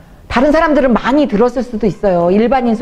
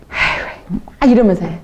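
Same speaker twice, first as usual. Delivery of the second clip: usual speaking voice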